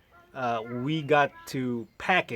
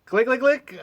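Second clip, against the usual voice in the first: high, loud clicking of a dead starter; imitated car clicking; 10 semitones higher, 5 dB louder than usual. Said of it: "Try one more time".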